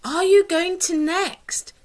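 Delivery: high bright question. Shown as a demonstration. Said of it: "replace that with the name of any shop".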